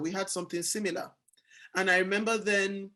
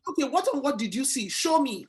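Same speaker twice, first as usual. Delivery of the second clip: eager; 5 semitones higher, 4 dB louder than usual. Retold or imitated. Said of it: "And I said".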